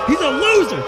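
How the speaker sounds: high, loud jeering commentator; mocking shout. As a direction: jeer at the loser. Commentator mocks missed putt.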